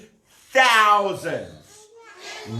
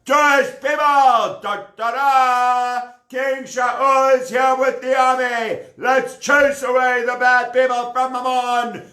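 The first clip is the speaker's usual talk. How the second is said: rallying call; 8 semitones higher than usual. Acted. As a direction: call out the army triumphantly. King announces rescue army.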